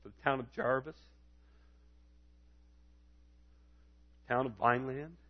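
soft, low prayerful appeal; name each town quietly, with long pauses; subdued call to pray, rising at each phrase end; hushed intercession. Real, not acted.

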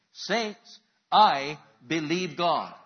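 loud, slow, high call to the congregation; call and response. Shown as a demonstration. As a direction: call it out so the saints repeat it. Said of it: "I want you to say".

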